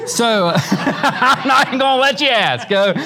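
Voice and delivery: high, slowed laughing tease; real laughter, playful and teasing; laughing joke. Real, not acted. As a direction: joke with them while laughing. Joking with the class.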